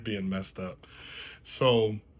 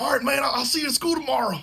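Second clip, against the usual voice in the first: much higher, louder, fast put-on voice; buddy's goodbye; 6 dB louder, much faster than usual. Buddy dropping him home, high fast put-on voice. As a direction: toss it off quick and high. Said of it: "he's like".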